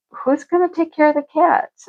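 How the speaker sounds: high, anxious question about the cats; worried question. Retold or imitated. To her husband, worrying who feeds the cats, high anxious question. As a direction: fret over who minds the cats. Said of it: "I'm like".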